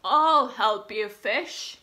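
loud, slow, hearty king voice; hungry king. Acted. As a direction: boom it out like a big kind king. Hungry king offers help.

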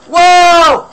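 very high, very loud startled cry; startled yelp. Real, not acted. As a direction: jump at a real scare. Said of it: "That really scared me".

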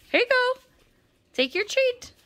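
high squeaky treat offer; squeaky praising a dog. Real, not acted.

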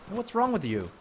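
ordinary delivery

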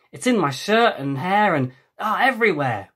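whiny complaint. Acted. Complaining about sand.

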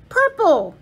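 yelled. A narrator's reading. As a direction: call it out loud as the arrow stops. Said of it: "she yelled".

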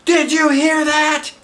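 high, loud slow squeal; thrilled kid bursting; excited. Acted.

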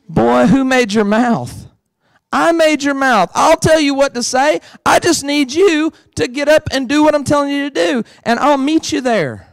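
a firm God setting Moses straight; God rebuking Moses. Retold or imitated. God answers Moses firmly.

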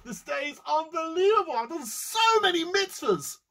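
high, loud ecstatic boasting; ecstatic gloating. Acted. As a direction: gush over your own good deeds. Rich man gloating ecstatic.

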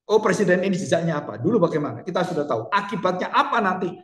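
ordinary talk, nothing marked

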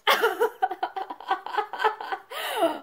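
high, mocking laugh; scornful laugh. A narrator's reading. Husband laughs scornfully at Pansy's defiance. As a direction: sneer with a laugh. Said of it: "then he laughed".

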